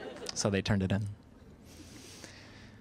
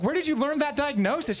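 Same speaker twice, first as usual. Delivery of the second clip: pitched way up in disbelief; high shocked question; 11 semitones higher than usual. Retold or imitated. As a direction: squeak it in shock. Shocked uncle quoting himself.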